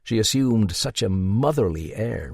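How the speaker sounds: usual speaking voice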